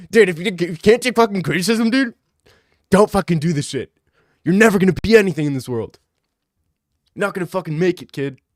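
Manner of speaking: loud, high mock tirade; mock rant. Streamer imitating the troll commenter, hammed-up rant.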